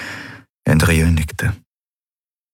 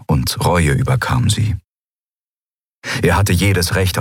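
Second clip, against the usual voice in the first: usual speaking voice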